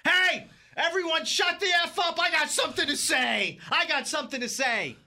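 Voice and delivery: mock screaming psycho from across the bar; psychopath screaming. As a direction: yell it to silence a packed bar. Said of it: "starts screaming at the top of his lungs".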